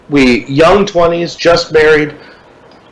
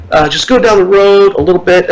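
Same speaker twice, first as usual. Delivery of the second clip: put-on friendly, higher Puerto Rican accent; nice local kid; 5 semitones higher than usual. Kid on a bike pointing the tourist down the road, friendly accent. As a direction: help him out kindly. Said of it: "he was really trying to be nice".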